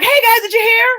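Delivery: high, loud eager blurt; excited kids blurting news. Retold or imitated. Kids rushing in from the bathroom, excitedly blurting news. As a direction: burst in breathless to share the gossip.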